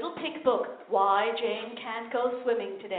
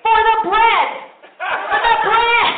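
loud, higher, second slow and laughing; shouted punchline; 8 semitones higher, 10 dB louder, much slower than usual. Acted. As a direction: bellow for the bread.

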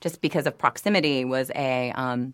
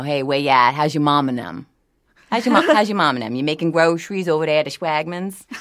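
New Orleans yat accent; 4 dB louder than usual. Shown as a demonstration. Voice actor showing hosts a New Orleans yat accent. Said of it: "could you say something with a New Orleans accent?".

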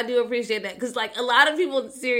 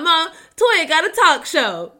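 higher, louder, slow, a proud uncle's announcement; uncle's excited announcement; 8 semitones higher, 10 dB louder, slower than usual. Retold or imitated. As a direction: call it out proudly and slowly. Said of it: "He was like".

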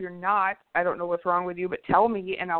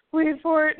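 louder, higher repeat about to burst; building anticipation; 6 semitones higher, 5 dB louder than usual. Retold or imitated. Co-host retelling the wait in the intro, bursting with anticipation. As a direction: punch it up as the giggles break through. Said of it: "it got real quiet".

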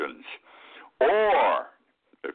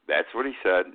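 ordinary talk, nothing marked

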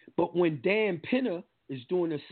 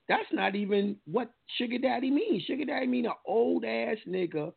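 indignant correction; 7 semitones higher than usual. Real, not acted.

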